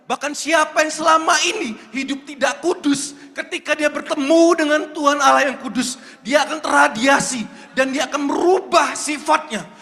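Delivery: loud, rising preacher's cry, building to shouts; preaching at full force. Acted. Preacher to youth about meeting God, shouting. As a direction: preach it hard to the congregation.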